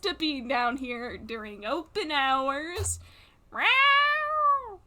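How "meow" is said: high meow like a cat. Pretend. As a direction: mew like a small cat. Cat behind the counter.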